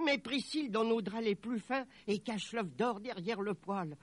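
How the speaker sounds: high-pitched, excited instructions; elated mother. Acted. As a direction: fuss happily over the precious treasure. Overjoyed mother gives orders.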